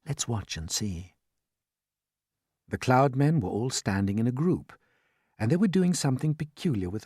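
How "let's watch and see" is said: low, slow and hushed; quiet, measured undertone; soft answer. A narrator's reading. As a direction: say it quietly, watching closely. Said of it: "James answered softly".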